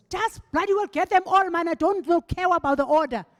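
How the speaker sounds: high, loud imitation of a furious minister; mad boss. Mad minister answers assistant asking whom he wants first, furious. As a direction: blow up at the assistant in a mock rage. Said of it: "whoever had made him mad".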